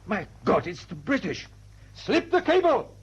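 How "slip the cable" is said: high, slow bellowed command; shouted order. Acted. Captain shouting to crew to slip cable, loud. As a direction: bark it across the deck.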